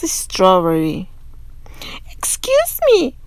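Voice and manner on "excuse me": put-on much higher, sweet old lady voice; a sweet old woman at the door, bright and high. A narrator's reading. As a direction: chirp it like a kindly grandma. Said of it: "she said brightly".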